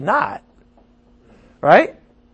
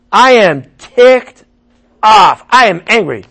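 acted anger; 5 semitones higher, 8 dB louder than usual. Pastor plays a repenter angry at himself, louder acted anger. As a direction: punch it out like a furious sinner.

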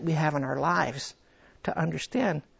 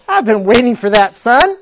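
louder, fond answer as God; God's reply; 5 semitones higher, 11 dB louder than usual. Acted. God answering the praying son, louder. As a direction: answer like a pleased father. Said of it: "It's like me".

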